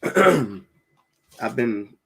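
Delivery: ordinary talk, nothing marked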